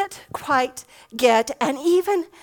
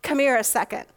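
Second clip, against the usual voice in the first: normal talking voice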